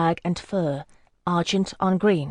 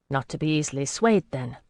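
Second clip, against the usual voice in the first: plain reading voice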